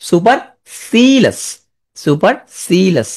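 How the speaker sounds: সুপারসিলাস said very slowly, syllables spread; slow spelling drill. Shown as a demonstration. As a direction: stretch সুপারসিলাস out for the class to spell. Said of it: "এখানে এল এল একটা". (সুপারসিলাস ধীরে টেনে উচ্চারণ)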